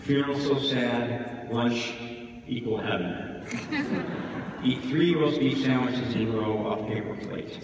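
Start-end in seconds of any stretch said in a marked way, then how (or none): none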